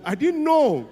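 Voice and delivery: high, loud comic exclamation; exclaiming. Real, not acted. Comedian bursts out loudly at his own ignorance. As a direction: burst out in disbelief. Punchline outburst.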